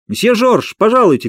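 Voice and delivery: high call from behind a door. A narrator's reading. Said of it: "крикнул кто-то за дверью". (высокий громкий зов из-за двери)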